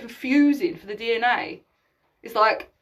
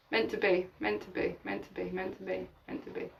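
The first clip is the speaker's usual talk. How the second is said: fading away; 4 semitones lower, 10 dB quieter than usual. Real, not acted. Repeating a phrase to close.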